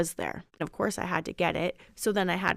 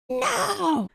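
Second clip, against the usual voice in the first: put-on sharp, much higher little-boy no; a child's flat refusal, pitched way up; imitating her son's no; 13 semitones higher than usual. Mom mimics her son refusing to sit with dad, high.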